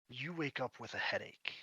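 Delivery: ordinary talk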